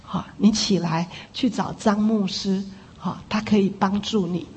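low, softened comforting voice; Jesus. Retold or imitated. Jesus tells the weeping sister to get up, low calming voice. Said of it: "那个声音又在说话了".

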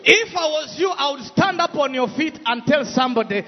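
rising, shouted declarations; preaching building up. Acted.

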